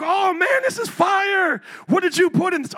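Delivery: high, loud, hyped-up food praise; raving praise. Retold or imitated. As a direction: rave about the dish like it's the best you've ever had. Praising someone's cooking.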